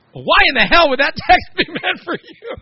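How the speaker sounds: hammed-up wife demanding an explanation; wife's incredulous retort.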